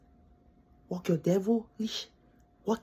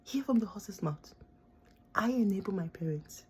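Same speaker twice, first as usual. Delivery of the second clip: whisper; 6 dB quieter than usual. Real, not acted. Whispering firsthand truth to her viewers.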